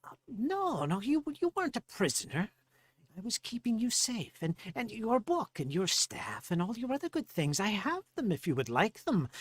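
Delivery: high-pitched, placating gnome voice; high little gnome insisting on terms; insistent gnome. Acted. Gnome correcting Farley about being a prisoner, high and insistent. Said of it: "And he replies back to Farley".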